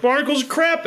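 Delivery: high-pitched mock alarm; yelped in pitch, not in volume; retold exclamation. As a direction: replay a sudden painful discovery. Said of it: "and it was like".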